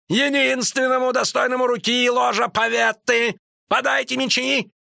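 high, indignant call to arms; sustained high-pitched furious tirade; Рейнфарн shouting. A narrator's reading. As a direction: rage and challenge the whole hall. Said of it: "крикнул, вставая".

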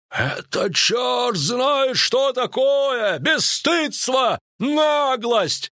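high, drawn-out outraged yell; furious high-pitched shouting; Висигерд bellowing. A narrator's reading. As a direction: bellow in indignation, stretching the words. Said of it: "орал Висигерд".

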